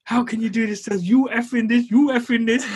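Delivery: imitated high-pitched angry tirade; mock-scolding in mum's high voice; mum swearing. Mum swearing at the letting agent, imitated indignation. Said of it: "she's actually swearing at him".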